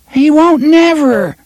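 hoping hard it won't happen; agony of hope. Acted. Third boy betting on the dog, high and eager. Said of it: "in an agony of hope and anticipation".

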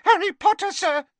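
an excited elf calling to Harry; squeaked. A narrator's reading. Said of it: "squeaked Dobby".

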